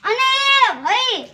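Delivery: calling out. Acted. Hailing a brother about his field, calling out. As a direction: stretch the call out to reach him.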